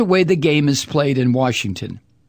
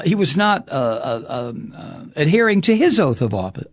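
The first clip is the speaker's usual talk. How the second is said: ordinary talk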